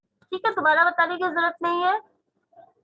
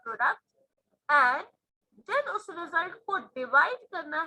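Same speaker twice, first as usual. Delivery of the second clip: slow dictation; slower than usual. Acted. Reads word problem slowly.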